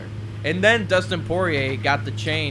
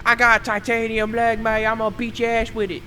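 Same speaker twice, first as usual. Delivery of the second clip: a bad Irish brogue, pitched way up; attempted Irish accent; 9 semitones higher than usual. Joking about Conor's titanium leg, badly faked Irish accent. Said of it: "I can't do an Irish accent".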